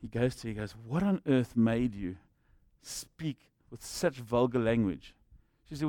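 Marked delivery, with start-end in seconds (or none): 0.7-5.1 low, hushed, drawn-out disbelief; slow incredulous question. Retold or imitated. Pastor goes to her in church, slow hushed disbelief. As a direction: draw each word out slowly and softly.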